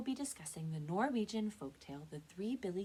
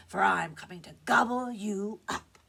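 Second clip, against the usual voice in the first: loud, rough troll threat; 3 semitones higher, 11 dB louder than usual. Acted. Troll threatens to eat the second goat, loud and rough. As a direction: boom the threat with menace. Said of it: "said the troll".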